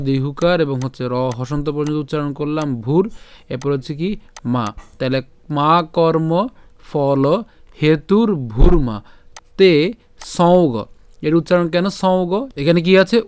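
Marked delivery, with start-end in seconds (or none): 5.6-10.7 slow, separated মা কর্ম ফল হেতুর ভূর মা তে সৌগ; careful Sanskrit recitation. Shown as a demonstration. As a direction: give মা কর্ম ফল হেতুর ভূর মা তে সৌগ word by word, long vowels held. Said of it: "তাহলে উচ্চারণটা কেমন হবে?". (ধীরে আলাদা করে মা কর্ম ফল হেতুর ভূর মা তে সৌগ)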